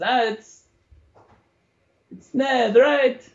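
high, bright showman voice; jovial performer. Acted. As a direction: play the cheerful stage performer. DM voices Octavio in character.

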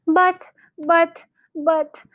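high, halting, repeated protest; spluttering cry. A narrator's reading. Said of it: "cried Missus Gregg, going purple in the face".

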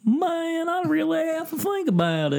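put-on high young boy voice; [name] impression. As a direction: muse like a high-pitched kid. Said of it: "I like your Mason impression".

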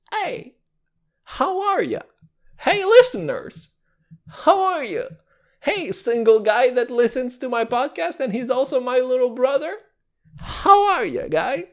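very high, put-on chummy hello; mock listener greeting. Host pretends to chat with his listeners, mock high-pitched greeting. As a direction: call out to the audience in a silly high voice.

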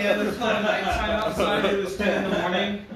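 mock your friend's mix-up; mocking tease. Real, not acted. Teasing friend's mixed-up story.

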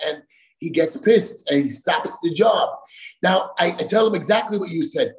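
normal talking voice

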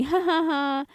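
high, drawn-out ha-ha laugh; gloating laugh. Real, not acted. Gloats that he got heartbroken.

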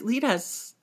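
high, small pleading voice; mock helpless follower. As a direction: imitate a useless tagalong begging for guidance. Acted helpless followers, mocking.

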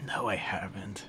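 whispered confession. Real, not acted. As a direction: breathe it unvoiced. Unvoiced whisper.

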